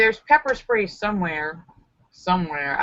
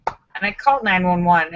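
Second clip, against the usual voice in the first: normal talking voice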